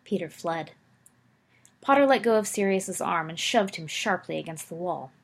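plain reading voice

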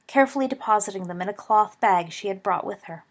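ordinary delivery

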